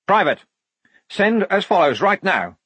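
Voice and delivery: high, urgent lieutenant; croaked order. A narrator's reading. Lieutenant cutting off the private, urgently croaked. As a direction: push out the order with a touch of a croak. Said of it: "Blouse croaked".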